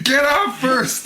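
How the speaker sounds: high-pitched mock yell; imitated corner shout. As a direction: call out the punchline like a cornerman. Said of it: "As Vitor's corner shouts".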